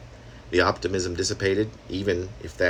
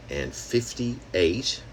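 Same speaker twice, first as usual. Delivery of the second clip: ordinary talk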